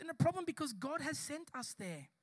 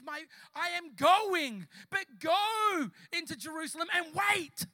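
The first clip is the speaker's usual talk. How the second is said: loud, high, drawn-out command; commanding preacher; 4 semitones higher, 7 dB louder, slower than usual. Retold or imitated. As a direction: proclaim it like a divine order. Preacher voicing Jesus' parting command.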